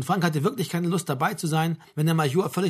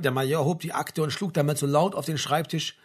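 usual speaking voice

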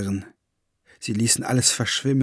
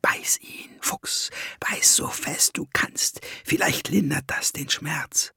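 urgent whisper; 3 semitones higher, 6 dB quieter than usual. A narrator's reading. Clara urges Fox to bite.

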